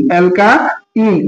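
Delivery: অ্যালকাইন stretched very slowly, each sound drawn out; slow demo. Shown as a demonstration. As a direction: spell out অ্যালকাইন slowly for the class. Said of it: "দেখছো?". (ধীরে টেনে টেনে অ্যালকাইন)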